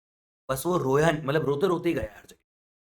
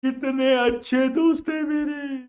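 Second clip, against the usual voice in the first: high, slow, crying voice; mock sobbing; 9 semitones higher, 5 dB louder, slower than usual. Punjabi weeping over his kind friends, mock sob. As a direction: wail it slowly like a weeping man.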